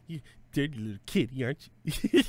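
creepy flirty voice. Acted. Talk like that.

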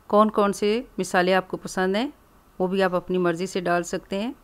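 normal talking voice

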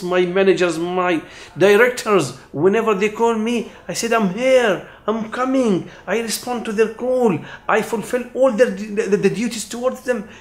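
high, impassioned pleading to God; fervent prayer. Acted. Preacher voicing a pilgrim answering Allah's call, impassioned. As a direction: pour your whole self out to Allah.

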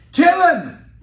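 loud mock protest chant; protest shout. Protester shouting at the prison gates, loud.

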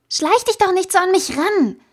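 hoch und laut, erschrocken wütend anfahren; gefaucht. A narrator's reading. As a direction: faucht ihn empört an. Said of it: "fauchte sie". (laut und hoch gefaucht)

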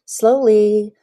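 ordinary delivery

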